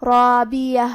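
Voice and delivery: chanted رابیہ with a thick, full "raa"; heavy ra and alif. Shown as a demonstration. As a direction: make the ر and long alif of رابیہ heavy and rounded. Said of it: "دونوں حرف کو بھاری آواز میں پڑھنا ہے".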